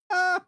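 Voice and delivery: very high loud howl; wolf howl. Acted. Wolf attack noise.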